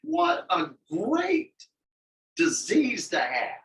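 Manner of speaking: slow, high, mock-amazed; drawn out ironic exclamation; sarcastic. Joking, slow and exaggerated.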